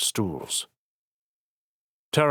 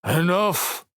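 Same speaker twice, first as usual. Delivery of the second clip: grumbling; 11 semitones higher than usual. Acted. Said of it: "Ship grumbled".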